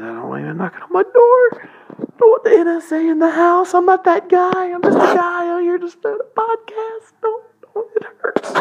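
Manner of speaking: high squeaky pleading voice; mock scared. Host pleads with the NSA in a squeaky mock-scared voice. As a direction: plead in a fake terrified squeak.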